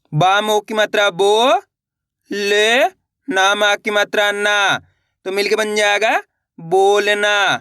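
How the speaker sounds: slow sounding out of letters; steady slow classroom spelling chant; phonics drill. Shown as a demonstration. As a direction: read each syllable aloud for learners to repeat.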